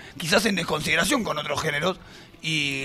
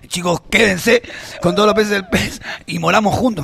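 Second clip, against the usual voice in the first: spooky otherworldly voice, louder; demonic double voice; 3 semitones higher, 6 dB louder than usual. Pretend. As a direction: voice from beyond the grave. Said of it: "Escuchá la voz de Ultratumba".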